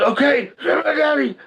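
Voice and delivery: high, loud retold yelp of surrender; panicked surrender. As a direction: give in at once, imitating a pinned bully. Acting the bully giving in.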